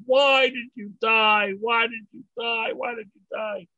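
slow, loud and high wail that fades by the third cry; hammed-up graveside bellow, drawn out. Mourner in the joke crying over a stranger's tombstone, mock bellow fading. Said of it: "And he's bellowing".

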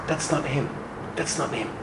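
a quiet sigh of disappointment. Retold or imitated. Rebbe sees it is only his son-in-law, quietly let down.

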